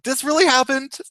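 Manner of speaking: high-pitched, incredulous questioning; amused disbelief. Retold or imitated. Bleeding man finding it funny, amused disbelief. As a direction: marvel at the absurdity of it. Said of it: "I thought it was funny".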